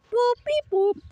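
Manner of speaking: high playful robot beeps; beep boop noises. Acted. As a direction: chirp like a gadget. Imitating machine beeps.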